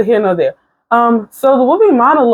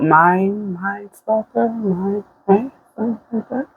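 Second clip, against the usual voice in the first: unsure muttering; 6 dB quieter than usual. Real, not acted. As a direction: murmur it as if searching your memory. Trying to recall a show title.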